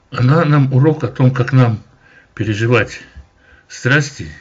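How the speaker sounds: ordinary talk, nothing marked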